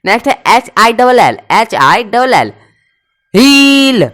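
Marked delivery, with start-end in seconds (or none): 3.3-4.0 हील held long, higher and louder; loud stretched हील. Shown as a demonstration. Teacher calling out हील for the class. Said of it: "सब में आपको आई दिख रहा है".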